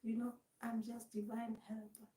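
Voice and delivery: soft, hushed understanding act; hushed mock-sympathetic voice; voice gone down. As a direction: speak softly, as if being very understanding. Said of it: "even your voice goes down".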